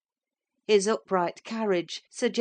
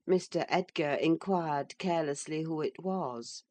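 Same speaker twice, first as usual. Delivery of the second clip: plain reading voice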